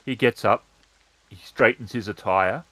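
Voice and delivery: ordinary delivery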